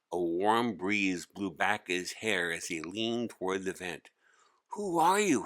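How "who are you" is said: ordinary delivery, nothing marked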